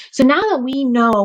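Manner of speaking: normal talking voice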